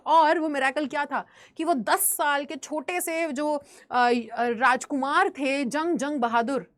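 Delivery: normal talking voice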